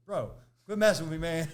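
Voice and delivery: high, loud yell at a prankster; loud protest. Retold or imitated. Man yelling at a buddy he thinks is pranking him, loud protest. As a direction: holler it, high and rattled.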